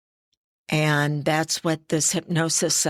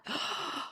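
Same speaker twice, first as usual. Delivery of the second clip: gasp; 14 dB quieter than usual. Real, not acted. Recalling people who do not.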